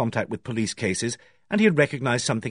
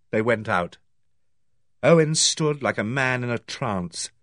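usual speaking voice

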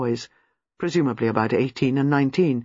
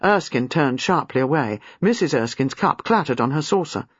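plain reading voice

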